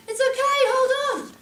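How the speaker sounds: high, loud call to hang on; shouted reassurance. Retold or imitated. Rescuer shouting back to the unseen woman, yelling. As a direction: yell back to the screamer. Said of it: "And I was like".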